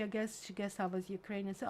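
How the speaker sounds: ordinary talk, nothing marked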